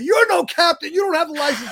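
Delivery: loud, high, mock-scolding objection; mock challenge. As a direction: imitate a friend loudly calling you out, half laughing. Acts out best friend's challenge.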